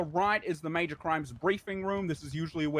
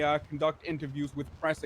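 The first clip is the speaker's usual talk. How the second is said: ordinary talk, nothing marked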